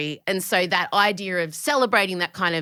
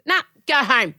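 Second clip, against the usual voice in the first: dismissive brush-off; 3 semitones higher, 5 dB louder than usual. Pretend. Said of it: "I wanna stand at the door and go".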